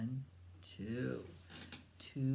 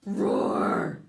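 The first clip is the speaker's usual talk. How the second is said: big loud roar; dragon roar; 11 dB louder than usual. Pretend. Parent leads child in a loud dragon roar.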